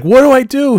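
high, loud cry for help; comic full-voiced panic; panicked yell. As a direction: yell helplessly at your companion. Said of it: "I'm yelling at Kim".

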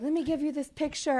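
high, bright offering tone; eager waiter. Retold or imitated. Waiter offering mobster picture.

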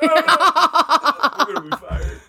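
high, slow words through laughter; laughing hard as he says it. Real, not acted. Joking to co-hosts about being fired, laughing.

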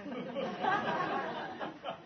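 high delighted laugh; joyful laugh at the happy ending. Real, not acted. Preacher laughs at the story.

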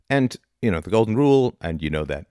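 normal talking voice

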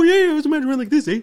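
high, fast cartoon Canadian; hammed-up Canadian with a tacked-on "eh"; mock Canadian accent. Shown as a demonstration. Host showing how a Canadian accent is pictured, exaggerated Canadian. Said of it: "picture a Canadian accent".

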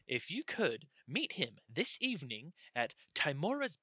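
high, piping gnome voice; squeaky gnome messenger. Acted. Gnome telling adventurers where to meet, high piping voice. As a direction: recite directions as a small eager gnome. Gnome gives the meeting place.

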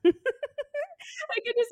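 high, slow words through a real laugh; high, slow laughing start. Real, not acted. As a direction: laugh your way into it. Laughing while picturing the dog.

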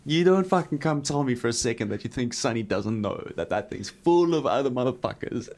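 ordinary talk

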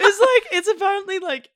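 joining in the laughter; laughing. Real, not acted. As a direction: laugh the words out. Laughing along with her friend.

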